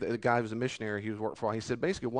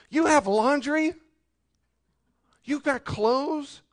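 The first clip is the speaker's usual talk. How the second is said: much higher, slow incredulous question; incredulous rebuke; 13 semitones higher, 7 dB louder, slower than usual. Acted. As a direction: stretch it out in mock disbelief. Preacher rebukes the complainers.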